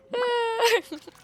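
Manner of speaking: a real high-pitched burst of laughter; loud giggling squeal. Real, not acted. Laughs after goodnight to Mac.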